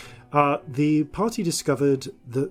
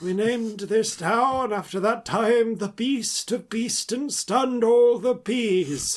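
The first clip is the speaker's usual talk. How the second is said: grand storyteller voice; 8 semitones higher, slower than usual. Pretend. Mock legend of town's name.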